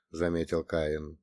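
usual speaking voice

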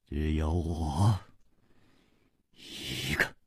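低沉缓慢，近乎耳语; 放轻声音，一顿一顿地说; 低声承认. Acted. Bitter admission, voice drops low. (末尾只剩气声)

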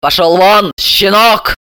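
short angry bark, moderately loud; barked dismissal. A narrator's reading. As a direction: gruffly order the kid out. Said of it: "рявкнула на него Бессарабова".

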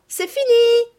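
loud, high, triumphant announcement; triumphant cry. A narrator's reading. Sophie turning round to the waiting children, triumphant cry. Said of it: "se retournant triomphalement, elle s'écria".

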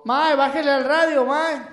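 to someone hard of hearing. Real, not acted. Caller's radio too loud.

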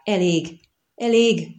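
narrated shout, moderately loud; crying out. A narrator's reading. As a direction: call out for it to stop. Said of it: "kiáltotta a vaják".

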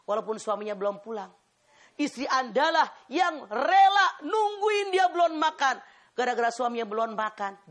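preacher praising wives at full force; fervent preaching. Acted. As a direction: drive each line home to the congregation. Preacher exhorts the congregation.

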